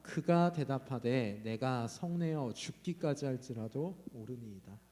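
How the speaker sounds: slow, even group reading of the verse; scripture read aloud together. Shown as a demonstration. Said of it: "같이 한번 읽어봅시다". (천천히 함께 성경 봉독)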